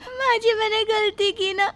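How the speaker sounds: high, tearful pleading through sobs; crying. Acted. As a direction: plead with mother-in-law while crying. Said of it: "Surabhi रो रही है".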